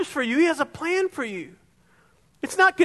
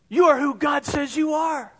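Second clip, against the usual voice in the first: preaching shout; 2 semitones higher than usual. Real, not acted. Preacher proclaims to church.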